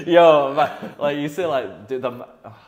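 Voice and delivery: loud, flustered protest; struggling to explain and admitting it loudly. Real, not acted. Man struggling to explain a slang word, loud and flustered. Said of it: "wow, this is hard".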